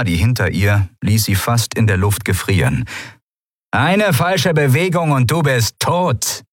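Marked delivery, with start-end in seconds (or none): 3.7-6.4 high, slow warning call; threatening command. A narrator's reading. Stranger catching Nelen from behind, threatening command. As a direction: threaten them in a raised, drawn-out voice. Guard threatens caught intruder.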